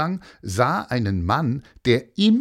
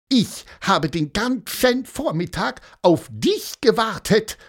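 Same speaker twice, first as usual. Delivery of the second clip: high, squeaky outrage; offended little character crying out; indignant; 10 semitones higher than usual. A narrator's reading. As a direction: protest in a high, put-on character voice, every word punched. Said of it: "rief Pieper empört".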